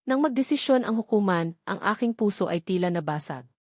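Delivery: usual speaking voice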